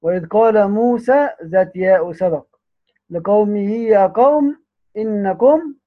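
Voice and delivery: normal talking voice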